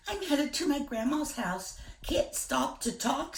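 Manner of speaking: put-on high little-girl voice, hurried and polite; Little Red. Little Red telling the wolf she can't stop, high girlish voice. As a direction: pitch up high like a small girl in a rush.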